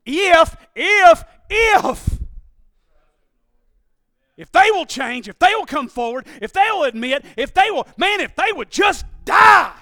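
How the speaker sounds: building from hesitant ifs to a loud cry; grudging mock prayer. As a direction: let the resentment burst out at the end. Heart's true grudging prayer.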